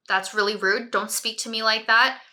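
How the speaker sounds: ordinary talk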